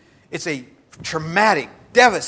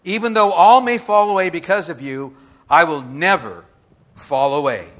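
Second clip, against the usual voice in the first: Peter's boast; 4 semitones higher, 10 dB louder than usual. Acted. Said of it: "Peter answers and says".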